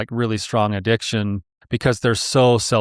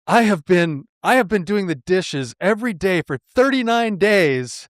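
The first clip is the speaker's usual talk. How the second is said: imitated exasperated complaint, pitched high; worked-up mock grievance, higher voice; frustrated; 7 semitones higher than usual. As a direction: act out an aggrieved husband venting. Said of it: "I'm so frustrated right now".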